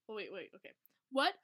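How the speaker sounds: plain reading voice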